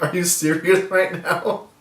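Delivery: imitating an incredulous comeback. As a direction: react in mock disbelief. Retelling a reaction to a bad condolence.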